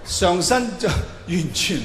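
normal talking voice

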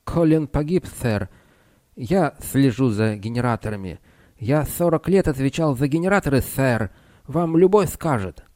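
put-on an old engineer, lisping and proud. A narrator's reading. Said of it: "прошепелявил голос".

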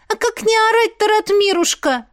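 high-voiced, indignant complainer; complaining girl. A narrator's reading. Дуняша complaining to Ратмир, aggrieved. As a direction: pitch it high and plead your grievance.

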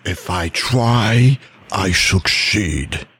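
"if I try, I succeed" slow, low and weighty; slow emphatic delivery. Shown as a demonstration. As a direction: stress each word, deep and slow. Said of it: "Emphasis on that".

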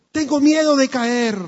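high, loud fearful cry; frightened child. Acted. Child at the window cries to his father, high and scared.

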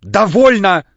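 высоко и громко; выкрикивает, задыхаясь; задыхающийся крик. A narrator's reading. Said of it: "задыхаясь". (крик, задыхаясь)

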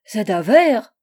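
put-on loud German-accented exclamation; indignant cry. A narrator's reading. Angel scandalised by the question, indignant cry. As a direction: cry it out, affronted. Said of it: "s'écria l'horrible objet".

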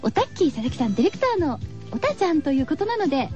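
ordinary delivery

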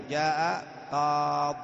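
"جاء قاض" drawn out slowly, final ya dropped, stopping on the d; pausal form qaad. Shown as a demonstration. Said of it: "نحو". (نطق "قاض" بحذف الياء والوقف على الضاد)

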